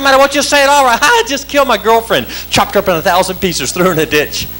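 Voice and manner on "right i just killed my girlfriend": ordinary talk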